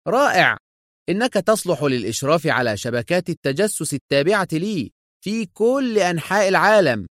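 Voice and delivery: high-pitched delight; pleased and exultant, pitched high; triumphant. A narrator's reading. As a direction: declare it with triumph. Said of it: "وتألقت عيناه في ظفر".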